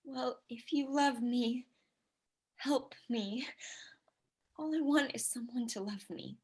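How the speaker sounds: soft pleading whisper. Acted. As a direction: barely breathe the plea. Bride pleading with groom.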